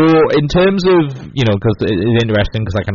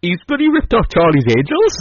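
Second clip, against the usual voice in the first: a touch of high indignant imitation; fake-exasperated quote; mock outrage; 8 semitones higher than usual. Retelling a radio host's complaint, mild mock outrage. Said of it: "And he went".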